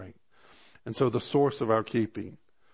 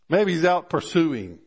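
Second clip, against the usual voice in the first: raised, teasing jabs at a sleepy god; mocking taunt; 6 semitones higher, 5 dB louder than usual. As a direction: taunt them with each excuse. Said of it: "He began to mock 'em".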